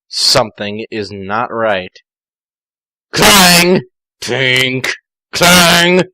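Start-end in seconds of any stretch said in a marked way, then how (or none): 3.1-3.8 mock clang. Sound effect read in fic. 5.3-6.0 mock clang. Sound effect read in fic.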